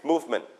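"movement" stressed on MOVE, falling away after it; first-syllable stress. Shown as a demonstration. Teacher modelling movement for class, stress on MOVE. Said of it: "you stress on the first syllable".

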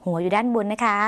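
ordinary talk

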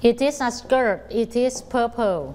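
each word stretched out; very slow model reading. Shown as a demonstration. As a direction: read it slowly so the children can repeat. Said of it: "ต้องช่วยกันฝึกอ่านนะคะ".

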